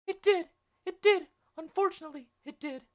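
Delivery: put-on high-pitched old nurse voice, loud and pressing; the Nurse explaining the killing, high and loud. Nurse's lines in read play.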